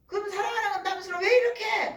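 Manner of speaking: high, loud mock protest; exasperated complaint. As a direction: throw it back as an exasperated wife. Wife retorting to the husband.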